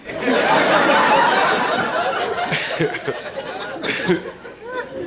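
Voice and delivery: long shrill-pitched cackle of laughter; squealing laugh; high squealing laugh. Real, not acted.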